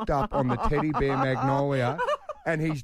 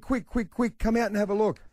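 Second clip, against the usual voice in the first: put-on much higher, hurried kid voice; urgent little girl; 10 semitones higher than usual. Daughter Sienna hurrying dad to the backyard, high urgent child voice. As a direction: rush the words like an excited five-year-old. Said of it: "And she said".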